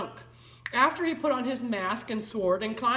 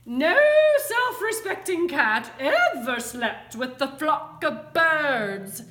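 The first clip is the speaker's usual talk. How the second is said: loud, high, drawn-out telling-off; scolding; 6 semitones higher, 7 dB louder, slower than usual. A narrator's reading. Mother cat lectures her bird-loving kitten, scolding. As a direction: scold like an exasperated cartoon mother. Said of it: "she scolded".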